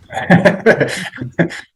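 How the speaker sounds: a short, bright burst of real laughter; a rueful real laugh. Real, not acted. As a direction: laugh out the embarrassment. Nervous laugh at confessing.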